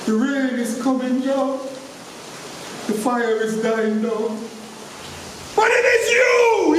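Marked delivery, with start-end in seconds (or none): 5.6-6.7 high, loud spitting accusation; spat out. Acted. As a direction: spit the words at him. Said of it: "he spat at me".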